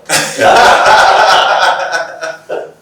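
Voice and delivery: bright burst of real laughter; amused laughing burst. Real, not acted. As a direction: laugh out loud at the joke. Laughs at crystal ball joke.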